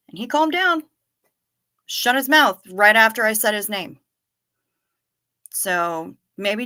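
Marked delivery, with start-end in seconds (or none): none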